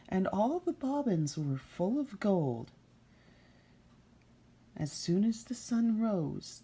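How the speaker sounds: put-on reading a fairy tale to children, unhurried; storyteller voice for a child listener; story narration. A narrator's reading. Reader telling Rumpelstiltskin to a child.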